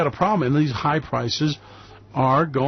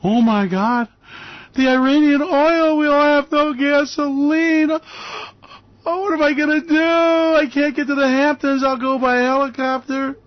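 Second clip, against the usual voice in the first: mock panicked rich Wall Streeter; 10 semitones higher, 3 dB louder than usual. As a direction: ham up a spoiled rich guy fretting over gasoline. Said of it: "the talking heads on Wall Street want".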